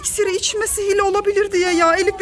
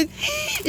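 soft mock gasp; 9 semitones higher, 7 dB quieter than usual. Finds potion left behind.

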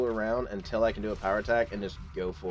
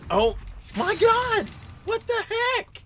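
very high-pitched startled shriek; shocked scream. Real, not acted. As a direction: cry out high in sheer shock. Ambushed in game.

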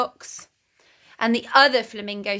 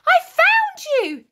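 high, louder, delighted exclamation; excited cry; 5 dB louder than usual. A narrator's reading. Simon finding the voice under the drawers, excited cry. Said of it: "he cried".

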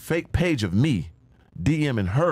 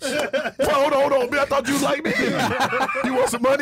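high laughing protest at being asked to pay; mock outraged disbelief; 13 semitones higher, slower than usual. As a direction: act shocked and offended, half laughing. Acted outrage at being asked money.

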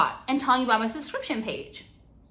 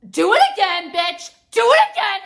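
high, loud threatening yell; raised-voice confrontational dare; angry dare; 8 semitones higher, 6 dB louder than usual. Real, not acted. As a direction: shout a furious challenge. Daring offenders during a rant.

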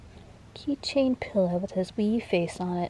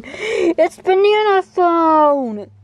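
sing-song announcement; 9 semitones higher, 9 dB louder, much slower than usual. Pretend. Owner announcing the toy to her cat, slow high sing-song. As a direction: stretch it out like a jingle.

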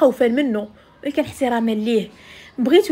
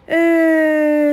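slow, loud drawn-out "aah"; long drawn-out sigh; 3 semitones higher, 5 dB louder, much slower than usual. Real, not acted. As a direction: let out a long heavy sigh. Long drawn sigh before moving on.

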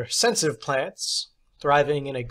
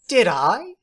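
high, louder teacher's voice; theatrical teacher; 9 semitones higher, 6 dB louder than usual. Acted. Substitute teacher praising John, high and theatrical. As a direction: play the odd substitute, grand and pleased.

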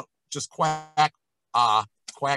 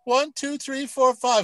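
high counting; 10 semitones higher, slower than usual. Shown as a demonstration. Coach shows what high notes feel like. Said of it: "is what the high notes feel like".

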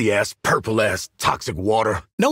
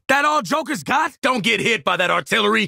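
combat shout; 7 semitones higher than usual. Acted. Combat taunt and warning.

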